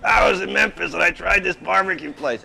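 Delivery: high-pitched mock customer voice; hammed-up imitation of a customer bragging; imitated customer. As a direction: pitch it up like an excited regular reporting his trip. Imitating customer telling of travels.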